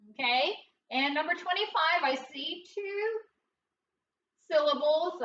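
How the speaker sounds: ordinary talk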